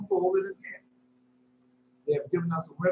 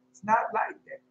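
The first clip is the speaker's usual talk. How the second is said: ordinary talk, nothing marked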